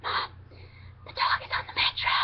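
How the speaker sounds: urgent hushed whisper into the phone. Retold or imitated. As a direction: whisper so the train can't hear. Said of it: "and I was whispering".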